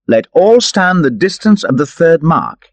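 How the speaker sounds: rally the men with a call; a touch of Robin addressing his band. Acted. Robin's voice, far timbre.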